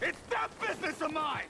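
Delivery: high, angry outburst; furious shout. Acted. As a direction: yell it in fury at an enemy. Confronts poachers, shot just fired.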